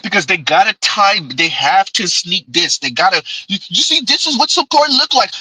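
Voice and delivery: ordinary talk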